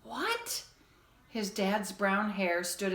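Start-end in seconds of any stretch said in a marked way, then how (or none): 0.0-0.6 startled. A narrator's reading. Father jolted awake.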